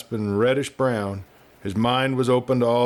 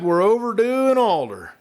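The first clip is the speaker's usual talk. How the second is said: high, raised cheerful call; cried out; 10 semitones higher, 3 dB louder than usual. A narrator's reading. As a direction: call ahead, eager to get moving. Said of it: "he cried".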